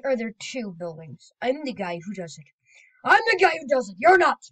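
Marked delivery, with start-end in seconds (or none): 3.0-4.4 loud, high mock shouting; mock argument. Two voices bickering over who does it, loud. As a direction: shout the claim in a hammed-up bicker.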